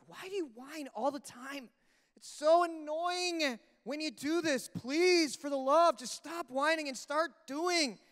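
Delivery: high, drawn-out pleading complaint; hammed-up scolding that whines itself; exasperated parent. Parent scolding oldest child for whining, exasperated. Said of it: "I've been on my oldest about whining".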